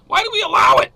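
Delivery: high, loud outraged demand; angry shout. Real, not acted. Host fuming to viewers over citizenship grants, loud angry shout. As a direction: hurl the question at the viewers in disgust.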